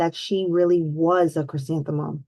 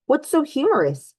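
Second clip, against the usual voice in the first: curious teacher; 7 semitones higher than usual. Acted. Teacher questions laughing class.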